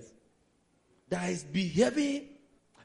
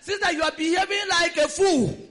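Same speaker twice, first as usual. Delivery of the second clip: high, loud scolding; telling off the fake brother, loud and high; loud rebuke; 8 semitones higher, 7 dB louder than usual. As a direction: shout the rebuke at her. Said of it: "tell sister".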